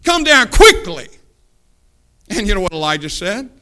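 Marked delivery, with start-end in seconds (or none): 0.0-1.1 high, loud demand, "quickly" punched; demanding, with attitude. Shown as a demonstration. Second captain outdoing the first, loud demand on "quickly". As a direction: bark "Come down quickly" as an impatient order. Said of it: "even more of an attitude".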